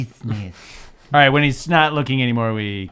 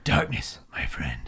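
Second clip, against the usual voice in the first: brooding whisper; 12 semitones lower, 8 dB quieter, slower than usual. Pretend. Edgy hero persona, whispered.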